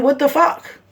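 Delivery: exasperated. Real, not acted. Mother ranting about the news coverage, exasperated. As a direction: throw up your hands in disbelief.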